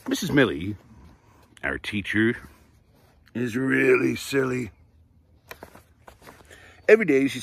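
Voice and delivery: lively, bright read-aloud storytelling; reading to children. Acted. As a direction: read it like story time for little kids. Reads picture book aloud.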